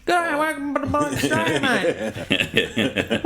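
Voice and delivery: high-pitched broad Australian caricature; hammed-up Aussie zookeeper greeting; Steve Irwin. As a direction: put on an exaggerated, high Australian accent.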